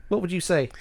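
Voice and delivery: normal talking voice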